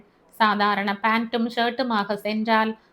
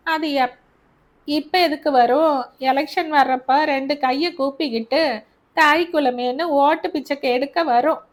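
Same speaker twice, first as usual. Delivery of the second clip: higher, louder sarcasm; scornful mother; 4 semitones higher, 4 dB louder than usual. Acted. Mother scorning the MLA to Albert, higher and sarcastic. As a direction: sneer at the vote-begging MLA.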